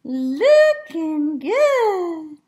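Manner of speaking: loud, high, very drawn-out cheer; big cheer. Real, not acted. Teacher gives listeners a looking good cheer, drawn-out cheering. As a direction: whoop it out slowly, stretching each word. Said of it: "give you a looking good cheer".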